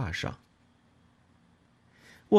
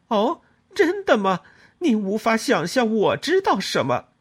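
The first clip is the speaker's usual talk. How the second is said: 高声尖刻地反问; 用嘲讽压住对方; 讥讽怒斥; 11 semitones higher, 6 dB louder than usual. A narrator's reading. 争吵中讥讽回击. (高声尖刻地讥讽反问)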